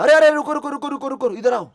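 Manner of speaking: high, urgent call-back, repeated in a rush; calling out after someone. Retold or imitated. Ram calling Hanuman back from behind, high urgent call. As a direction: call him back loudly from behind, pitch jumping up. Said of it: "Ram ने पीछे से बुलाया".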